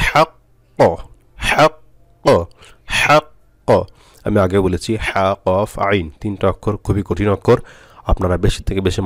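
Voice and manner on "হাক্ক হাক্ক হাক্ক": হাক্ক high, held shut, then popped open; throat h, hold the q of হাক্ক, then release an echo; strong qalqalah. Shown as a demonstration. Teacher shows stopping on doubled qaf, strong bounce. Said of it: "সাকিন করলে কি হবে?".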